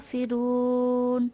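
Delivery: ফিরুন slowed, vowel drawn one count; one-alif stretch. Shown as a demonstration. Teacher shows the short stretch, slow. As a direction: hold the vowel in ফিরুন a beat. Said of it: "এক আলিফ টেনে পড়তে হবে".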